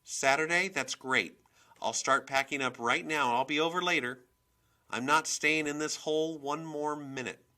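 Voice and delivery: high, loud, insistent chatter; worked-up mother on the phone; agitated phone talk. A narrator's reading. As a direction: vent loudly to your boyfriend. Agitated phone call to boyfriend.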